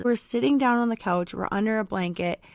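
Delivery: ordinary delivery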